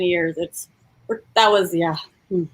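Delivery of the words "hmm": ordinary delivery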